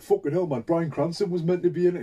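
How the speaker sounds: hammed-up fan outrage; mock outraged fans. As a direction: whine it like a cheated fan. Mocking fans' complaint.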